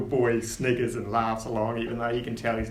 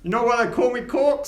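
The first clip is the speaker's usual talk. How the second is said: gnome voice; 11 semitones higher, 6 dB louder than usual. Acted. Gnome Corks speaking.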